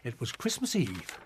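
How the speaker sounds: usual speaking voice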